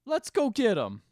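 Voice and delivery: high, loud rallying cry; Shaggy. Retold or imitated. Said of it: "Shaggy starts being sad".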